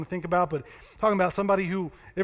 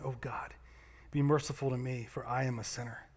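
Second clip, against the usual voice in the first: much softer, lower, broken-down prayer; humble plea; 6 semitones lower, 8 dB quieter than usual. Acted. The tax collector begging God for mercy, soft and low. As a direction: plead quietly with a lowered head. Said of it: "beat his chest in sorrow".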